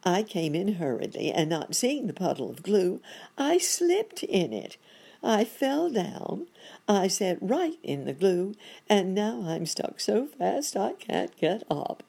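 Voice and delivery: high, animated character voice; hapless monkey doctor. Acted. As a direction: recount the mishap as a flustered monkey.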